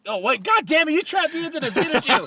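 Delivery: loud, high-pitched sudden realisation; playful outrage. Real, not acted. Catching the co-host who baited his nerdy rant, playful outrage. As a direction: burst out in laughing exasperation at being tricked.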